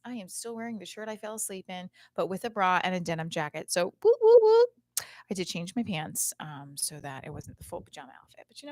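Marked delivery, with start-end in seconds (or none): 4.0-4.7 high, loud "whoop" cheer; playful whooping. Real, not acted. Host jokes about her outfit, playful whooping.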